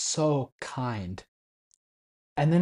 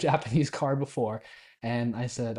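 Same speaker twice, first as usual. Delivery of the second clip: ordinary talk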